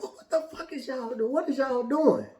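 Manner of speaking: put-on a mother catching kids mid-deed; high startled outcry; shocked mom.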